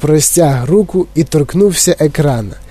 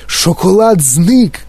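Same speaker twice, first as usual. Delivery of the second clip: drawn-out, higher-pitched mild cry; Дідунь Джо; 5 semitones higher, slower than usual. A narrator's reading. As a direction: cry out slowly in astonishment, without full volume. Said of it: "крикнув дідунь Джо". (протяжний стриманий вигук)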